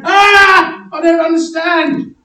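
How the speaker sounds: high, loud screams of confusion; hammed-up panic. Preacher acting out bewildered disciples running around, hammed-up panic. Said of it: "Even then they go".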